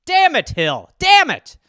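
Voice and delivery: loud, high frustrated shout. Real, not acted. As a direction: yell at the wrong guess. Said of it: "surprising cheese additives".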